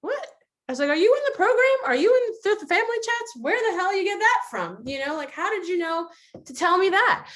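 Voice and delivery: very high, loud mock disbelief; incredulous reaction. Acting out her stunned reply.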